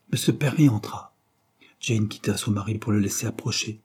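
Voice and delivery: ordinary delivery, nothing marked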